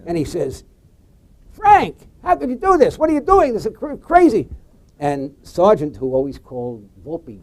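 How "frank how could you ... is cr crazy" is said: high, exasperated scolding; berating. Retold or imitated. Volpe berating Sargent over the highway decision, high and exasperated. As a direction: scold him like a furious boss. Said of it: "he starts berating Sargent".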